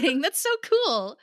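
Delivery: thrilled squeal of approval; excited. Real, not acted. Cohost thrilled by the hometown news, excited. Delighted about Minnesota actress.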